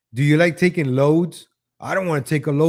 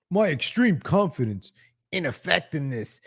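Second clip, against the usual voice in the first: failed impression; 5 dB quieter than usual. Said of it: "I can't do that voice".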